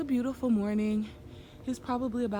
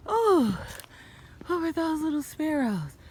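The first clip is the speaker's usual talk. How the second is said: surprised; 8 semitones higher than usual. Real, not acted. Surprise at birds on trail.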